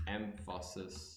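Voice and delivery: emphasis said slowly, low and soft; word breakdown. Shown as a demonstration. Doing a word breakdown, slow syllables. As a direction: break emphasis softly into syllables, em-fuh-sis. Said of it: "We're doing a word breakdown right now".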